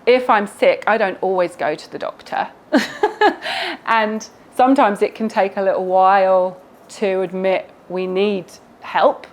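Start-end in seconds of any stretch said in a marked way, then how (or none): 2.7-3.8 short, high chuckle; light laugh. Real, not acted. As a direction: chuckle at your own admission. Laughs at own admission.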